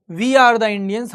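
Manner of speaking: usual speaking voice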